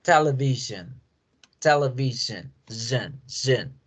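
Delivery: "television" with a zh, then "zhen" alone; English television. Shown as a demonstration. Teacher isolates the sound for the class. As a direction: stretch the zh in "zhen". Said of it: "Miren el sonido".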